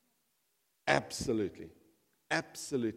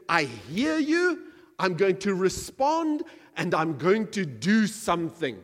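loud, rising sermon climax; preaching at full force; 5 semitones higher, 11 dB louder than usual. Acted. Preacher voicing a faithful people, loud full-force preaching. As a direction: preach it out to the whole church.

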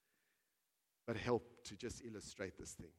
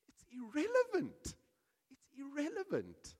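lightly voiced dismissal; 7 dB louder than usual. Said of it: "And they would go".

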